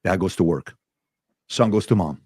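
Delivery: usual speaking voice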